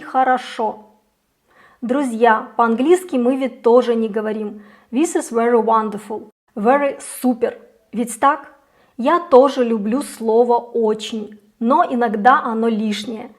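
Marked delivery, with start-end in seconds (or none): none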